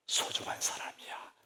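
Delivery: slow soft whisper; 아이에게 속삭이듯 부드럽게; whispered tenderly. Acted. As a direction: breathe it gently to a child.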